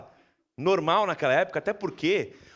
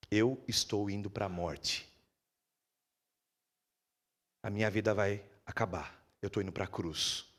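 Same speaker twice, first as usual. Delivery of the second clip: grave and sorrowful; 8 semitones lower, 6 dB quieter, slower than usual. Acted. Jesus foretelling his death.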